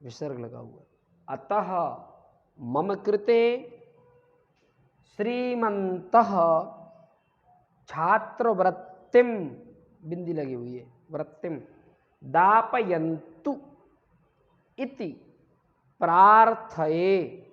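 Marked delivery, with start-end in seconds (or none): 1.3-9.5 "मम कृते" loud and drawn out; "श्रीमन्तः" slowly stretched to a final "ha"; repeat-after-me dictation. Shown as a demonstration. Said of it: "इसको पूरा repeat करिए". 11.1-17.5 "दापयन्तु" loud and very slow; word-by-word model of "दापयन्तु इति प्रार्थये" with pauses; repeat-after-me dictation. Shown as a demonstration. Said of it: "इसको पूरा repeat करिए".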